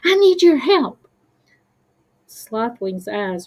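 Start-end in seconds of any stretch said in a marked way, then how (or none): 0.0-1.1 high, loud little creature voice; urgent plea. Acted. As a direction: beg for help in alarm. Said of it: "his voice filled with urgency".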